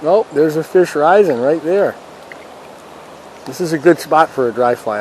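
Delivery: ordinary delivery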